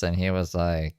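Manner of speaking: usual speaking voice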